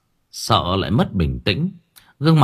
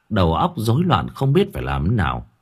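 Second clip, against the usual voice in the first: plain reading voice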